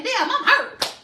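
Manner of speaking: high, hammed-up cry of pain; mock hurt voice. To her audience, about admitting pain, in a high mock-hurt voice. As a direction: play at being wounded, half joking. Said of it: "Because we're afraid to say".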